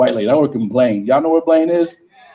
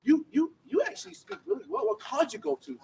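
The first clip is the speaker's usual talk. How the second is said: mock surprised customer; 10 semitones higher, 5 dB quieter than usual. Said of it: "they also start saying".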